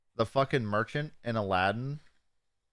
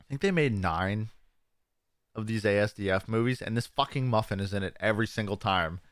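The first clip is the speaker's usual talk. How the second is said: normal talking voice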